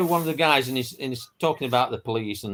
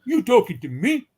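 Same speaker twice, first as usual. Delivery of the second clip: louder, higher tough-guy New York accent; 6 semitones higher, 3 dB louder than usual. Shown as a demonstration. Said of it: "try it again".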